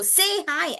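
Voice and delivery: put-on high parroting child voice; high-pitched childlike echo; little boy. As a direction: imitate a boy echoing an instruction. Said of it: "then he'll go".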